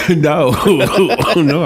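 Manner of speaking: real laughing protest. Real, not acted. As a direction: answer through helpless laughter. Laughing at own lost voice.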